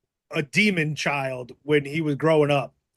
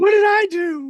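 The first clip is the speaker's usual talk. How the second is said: much higher, louder, squeaky disbelief; mock baffled shout; 6 dB louder than usual. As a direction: echo it as a hammed-up cry of innocence. Echoing friend's quote loudly.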